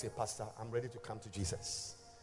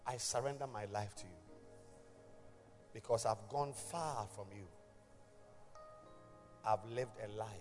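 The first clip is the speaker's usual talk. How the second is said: guided prayer; 2 semitones lower, 8 dB quieter than usual. Acted. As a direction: lead the prayer gently, line by line. Leads the sinner's prayer.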